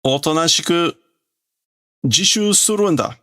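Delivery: put-on high kid voice, rising at the end; bossy classmate. Kid urges self-study.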